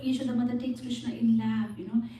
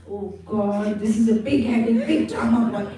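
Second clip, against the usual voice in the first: louder, laughing exasperation; exasperated; 8 dB louder than usual. Acted. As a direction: throw up your hands laughing. Exasperated listeners in story.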